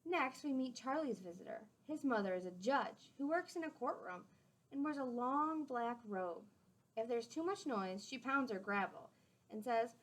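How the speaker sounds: gentle storybook read-aloud; clear, friendly storytime voice; reading to children. A narrator's reading. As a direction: read it out to the class like a picture book. Reading a picture book aloud.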